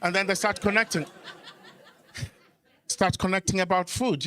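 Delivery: ordinary talk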